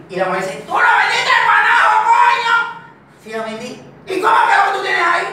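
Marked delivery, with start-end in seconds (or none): 0.7-2.6 very high, loud shout; furious mom yell, acted; angry mother scolding. Mother scolds returning boy. 4.1-5.3 high, loud interrogation; acted scolding shout; angry mother's demand.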